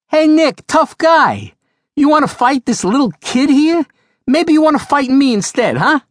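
put-on loud, high taunting New York accent; a tough Brooklyn girl calling out the bully in a New York accent; full New York accent. [name] challenging Nick the bully, taunting loud New York accent. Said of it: "said in full New York accent".

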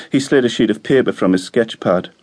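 ordinary delivery

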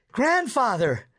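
put-on high-pitched boy's call to grandfather; excited shout. A narrator's reading. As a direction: call out high, without real volume. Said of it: "Lewis shouted".

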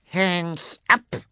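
high, sharp call of a lurking cat. A narrator's reading. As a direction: bark the order from the dark. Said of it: "Eine Stimme rief".

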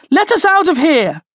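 high, forceful yell; demanding shout. A narrator's reading. Charlie demands the jailer free them, shouting. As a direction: yell for release through the bars. Prisoner demands release from cage.

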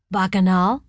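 mild amused question, rising; a hint of a chuckle on Bacchanal. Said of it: "He sounded amused".

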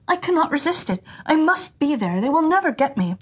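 bursting to run off; eager deer. A narrator's reading. Deer tells his sister he must join the hunt, eager.

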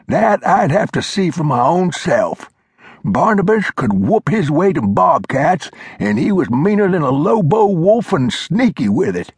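put-on high, reedy old-timer lecturing; old mountain man voice. A narrator's reading. Old Glover scolding Flintlock, high old-timer voice. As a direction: play the cranky old coot setting him straight.

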